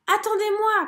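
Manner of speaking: appel aigu et fort; grenouille qui crie. A narrator's reading. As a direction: crier pour qu'on l'attende. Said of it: "crie une grenouille". (cri aigu de grenouille)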